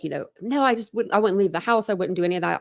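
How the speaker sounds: usual speaking voice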